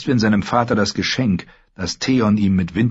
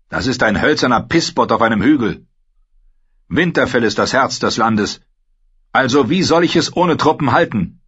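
put-on higher, louder young lord ranting; raised, frustrated voice of an embattled lord; Theon, angry and anxious; 5 semitones higher, 4 dB louder than usual. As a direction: build up the grievance, then demand. Theon argues.

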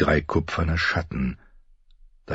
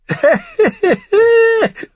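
very high, loud mocking cackle; high-pitched manic giggle, acted; mad laugh; 6 dB louder than usual. A narrator's reading. Brother laughing at the warning, shrill manic cackle. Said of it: "Viserys lachte".

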